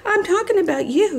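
high-pitched pet-scolding voice; playful telling-off. Real, not acted. Turns to tell off her dog.

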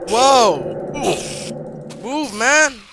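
loud, high, impatient yell; alarmed shout. Real, not acted. Gamer trailed by monsters orders one aside, alarmed shout. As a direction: shout it at someone blocking your escape. Blocked while being chased.

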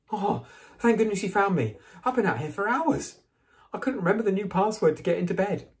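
high, urgent, anxious relief; loud, high, flustered boy; relieved gushing. A narrator's reading. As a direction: pour it out in high-pitched relief. Neville, found, gushes with relief.

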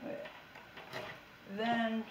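normal talking voice

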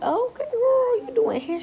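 squeaky mocking "okay girl"; mock girly voice. Mocking friend in high voice.

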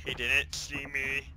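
loud slow gloating; gleeful disbelief. Real, not acted. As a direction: drag it out, thrilled he missed you. Hiding from enemy.

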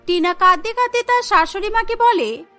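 plain reading voice

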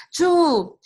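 high, loud startled cry; girl discovers her brother is gone, alarmed cry. A narrator's reading. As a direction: yelp it in alarm. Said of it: "Yemi cried".

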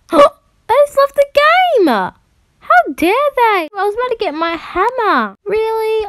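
high, loud, stretched-out indignant gasp; outraged disbelief. Real, not acted. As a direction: cry out that the game betrayed you. Kicked from game mid-robbery.

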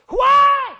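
sudden high yelling battle cry. Acted. Preacher acting out David with a battle yell. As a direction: yell it out as you pull the sword. Sword fight sound acted out.

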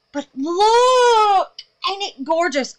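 high, loud, near tears; thrilled. Real, not acted. Showing viewers the soap, thrilled. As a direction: show it off proudly.

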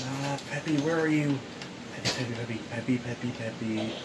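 soft, low repeated name; quiet searching. Pretend. Still hunting for Peppy, quietly repeating the name. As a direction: search half to yourself, very softly.

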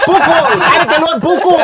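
high, loud cry through laughter; mock outburst. Comic mimicking a colleague's outburst, laughing high yell. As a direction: blurt it out in hammed-up exasperation. Acting out the tongue-tied comedian's outburst.

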